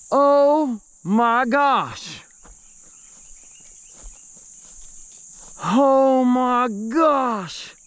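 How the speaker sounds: high, loud and slow, astonished; stretched out in disbelief, laughing at the end; amazed excitement. Real, not acted. Stunned by the big fish.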